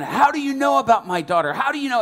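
loud, high indignant shouting; getting angrier. Retold or imitated. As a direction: imitate an enraged father demanding an answer. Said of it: "And he's getting more angry".

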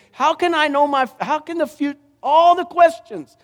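high-pitched volley of pleading questions; fervent preaching. Acted.

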